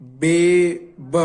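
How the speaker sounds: बेब drawn out very slowly; slow demo. Shown as a demonstration. Teacher slowly sounding बेब out for students. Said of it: "क्या?".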